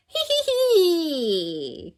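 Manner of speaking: high, drawn-out cackle; shrill play-witch laugh; witchy cackle. A narrator's reading. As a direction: cackle loudly like little witches. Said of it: "cackle Candy and Rebecca".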